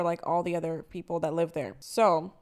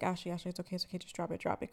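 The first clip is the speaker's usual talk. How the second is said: hushed calming; 9 dB quieter, much faster than usual. Retold or imitated. Friend calming her, retold.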